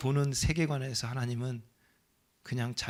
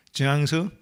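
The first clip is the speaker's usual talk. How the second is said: loud call across the waiting room; calling out a name; 7 dB louder, slower than usual. Retold or imitated. Hospital calling his name. (대기실에서 이름 부르듯 크게)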